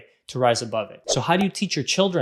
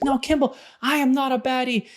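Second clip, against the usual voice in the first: put-on high-pitched indignant child's protest; upset child protest; 9 semitones higher than usual. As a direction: play an upset little girl insisting she's not a baddie. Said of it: "Juniper was very upset".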